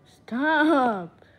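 loud shout of stop!; yell. Real, not acted. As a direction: yell it at the screen. Yelling at game character.

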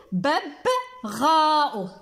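ببغاء drawn out with a long غا; slow, careful pronunciation for children; slow model word. Shown as a demonstration. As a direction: stretch the word so the غ is heard. Repeats word to find sound.

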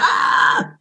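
high unvoiced startled shriek; mock scream. Girl startled by the boy, high unvoiced shriek.

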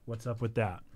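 trailing low and soft; soft echo. Real, not acted. Softer echo of own question.

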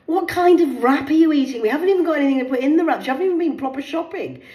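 high, loud, fast accusing daughter; scolding daughter. Retold or imitated. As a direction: tear into mum. Acted daughter scolding mum.

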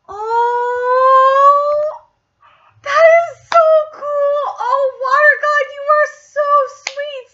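high, loud fangirl squeal throughout; excited squealing. Real, not acted. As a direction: squeal at the sweetness like a fan. Fan gushing over water god.

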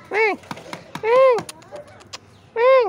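loud squeaky high call to the cat; loud high-pitched "here" to a cat. Real, not acted. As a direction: shout high to get the cat's attention. Calling the cat with food.

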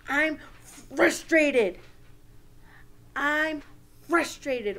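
loud, high, slow mock frustration; acted frustration. Shown as a demonstration. Teacher demonstrating how to name our feeling, acted frustration. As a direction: declare the feeling with hammed-up exasperation. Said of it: "to name our feeling".

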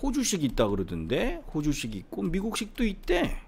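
mimicked learner's objection; imitating know-it-all students. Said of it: "여러분들이 생각하는".

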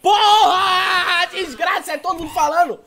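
loud, high yell through laughter; laughing rage. Real, not acted. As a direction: yell while cracking up.

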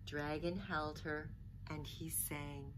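put-on expressive read-aloud for children; lively picture-book reading voice; storybook narration. A narrator's reading. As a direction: tell it like a bedtime story.

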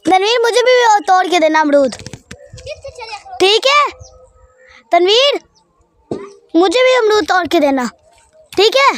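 high-pitched call across the orchard. Real, not acted. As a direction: call over to someone far off, pitch lifted. Calling to Tanveer to pick guava.

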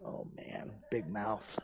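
rueful aside. Retold or imitated. Dad regretting his prayer advice, muttering under his breath. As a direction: grumble it to yourself.

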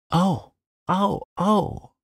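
high panicked yelp; frightened cry. A narrator's reading. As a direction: squeal in alarm. Jeremy seized by trout.

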